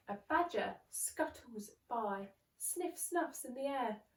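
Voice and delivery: ordinary delivery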